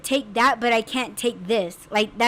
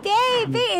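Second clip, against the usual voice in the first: mock call; 9 semitones higher than usual. Calling out, panicked.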